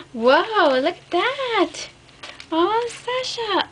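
high, slow marvelling; drawn-out awe at a gift; awed wonder. Real, not acted. Marvelling at the handmade album, slow high awe.

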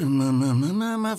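mm-mm skipping through words; skimming hum. Acted. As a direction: hum the rest of the line quickly. Skims the review by humming.

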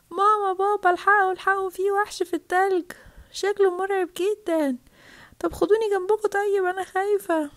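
high, pleading call for help; frightened child calling. Acted. Child begs sleeping mum and dad for help, frightened.

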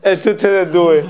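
laughing through the words. Real, not acted. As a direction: chuckle while saying it. Listener laughs at hugging story.

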